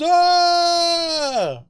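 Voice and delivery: high, hammed-up shocked "ah"; a cartoonish gasp of outrage; mock outraged gasp. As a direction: mimic a room gasping in fake horror. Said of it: "go all up in arms".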